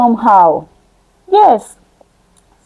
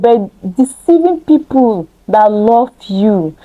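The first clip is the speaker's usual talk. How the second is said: usual speaking voice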